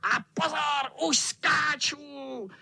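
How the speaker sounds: very high, long-drawn warning; high gleeful cat yell; tomcat crying out. Acted. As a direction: squeal it slowly before the pounce. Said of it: "vykřikl kocour".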